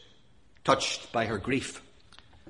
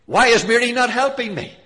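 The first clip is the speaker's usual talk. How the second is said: loud, high indignant protest; complaining; 8 semitones higher, 8 dB louder than usual. Acted. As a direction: voice Martha's grievance at full force. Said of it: "Martha complained".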